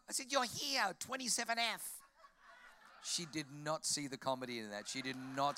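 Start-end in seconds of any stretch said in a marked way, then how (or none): 0.3-2.0 high, loud silly announcement voice; hammed-up high-pitched punchline; joking. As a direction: put on a comic high voice. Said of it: "I thought I'd be funny".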